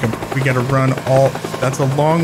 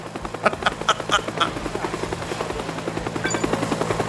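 breathy panicked laugh; 7 semitones higher, 9 dB quieter than usual. Real, not acted.

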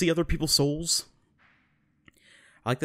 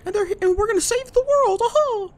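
squeaky, very high falsetto, louder; a hammed-up cartoon mouse voice; Mickey Mouse; 4 dB louder than usual. Mouse king telling of the kids he helped, loud squeaky falsetto. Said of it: "meets back up with Mickey".

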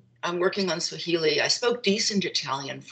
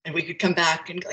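normal talking voice

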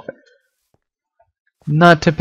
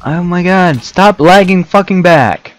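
furious high shout; angry yelling; 7 semitones higher, 7 dB louder than usual. Real, not acted. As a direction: yell at the lag. Raging at lag.

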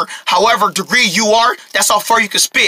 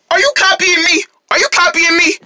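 indignant accusation; 8 semitones higher than usual. Acted. Accuses pigeon of copying him.

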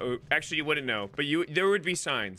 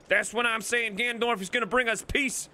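put-on higher-pitched country-accent ranter; hammed-up country accent; 9 semitones higher than usual. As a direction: argue like a country-accented voter. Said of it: "in this accent".

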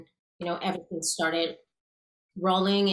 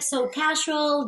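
ordinary talk, nothing marked

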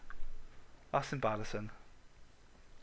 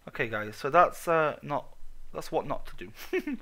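normal talking voice

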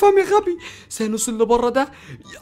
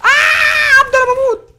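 high, loud yelp of panic; real pained scream; 8 dB louder, slower than usual. Real, not acted. Gamer yelling to Abdo as he is hit, loud panicked cry. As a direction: scream as if you're dying.

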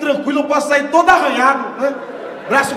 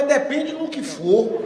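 slow wary reply; much slower than usual. Retold or imitated.

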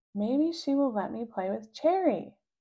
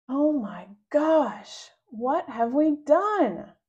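dismayed; 5 semitones higher, 5 dB louder, much slower than usual. Acted. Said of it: "covering his ears".

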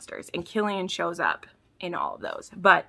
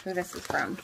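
usual speaking voice

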